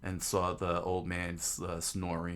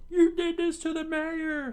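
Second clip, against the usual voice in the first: loud, very high falsetto outcry; accusing townspeople; 8 dB louder than usual. Acted. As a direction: accuse in an outraged put-on squeal.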